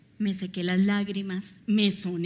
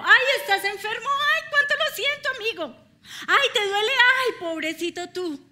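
high, loud, hammed-up fake sympathy; mock a careless "poor you" with a laugh in it; sing-song pretend pity, brushing the sick person off; mock indifferent pity; 11 semitones higher, 8 dB louder than usual. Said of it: "es pasar de largo".

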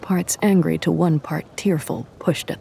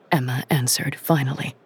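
ordinary delivery, nothing marked

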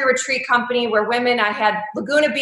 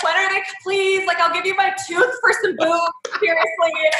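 mock teen plea; 6 semitones higher than usual. Teen self pleading with fairy.

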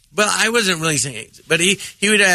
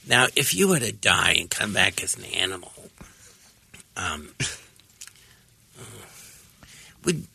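Harvey Fierstein impression; 9 semitones lower, 5 dB quieter than usual. Comic imitating Harvey Fierstein asking a guest, low-voiced. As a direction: lower voice, like a talk show host asking his guests. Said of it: "It's a really good impression of Harvey Firestein".

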